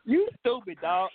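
usual speaking voice

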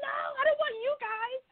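high-pitched, hurried mock shriek without volume; mock scream. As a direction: imitate your own panicked squeal, fast and high. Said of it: "And I started screaming".

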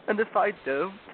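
high-pitched, tearful fawn; beginning to cry. A narrator's reading. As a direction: voice climbing towards tears. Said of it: "beginning to cry again".

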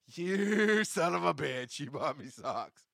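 slow, laughing "son of a bitch"; laughing mock curse. To the listener who bought him socks, laughing mock curse. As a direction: curse them fondly through a laugh.

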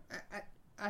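breathless whispered stumble; whispered stammer. Retold or imitated. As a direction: lose the voice to a whisper mid-stammer. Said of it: "I began fumbling".

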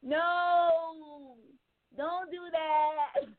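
high, slow prissy refusal; drawn-out mock refusal; high mock guy voice. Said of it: "Then they be like".

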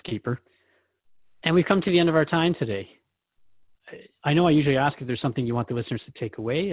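ordinary delivery

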